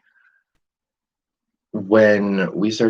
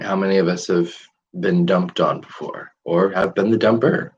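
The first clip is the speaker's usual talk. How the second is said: ordinary talk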